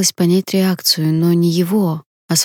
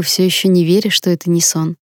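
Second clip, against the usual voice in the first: ordinary delivery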